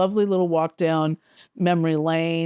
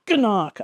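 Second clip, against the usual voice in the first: short comic punch noise, raised pitch; imitated punch sound; 4 semitones higher than usual. Showing the sound a punch makes, punchy imitated noise. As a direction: voice a cartoon punch landing. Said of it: "That's the sound that a punch makes".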